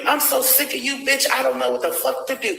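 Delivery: ordinary delivery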